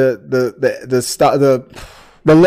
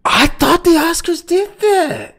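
surprised; 13 semitones higher, slower than usual. Real, not acted. Surprised at no casting award.

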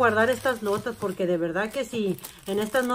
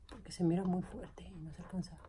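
breathy whisper, almost no voice; whispered aside; 11 dB quieter than usual. Real, not acted. Whispering an aside to her viewers about the light. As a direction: drop to a whisper as if telling a secret.

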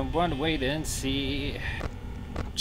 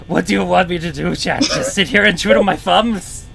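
exasperated; 6 semitones higher, 9 dB louder than usual. Real, not acted. Exasperated at chat.

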